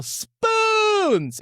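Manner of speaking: high drawn-out yell; long imitated audience shout, way up high; crowd yell. Imitating a crowd's reaction on screen, drawn-out yell. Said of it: "everyone would yell".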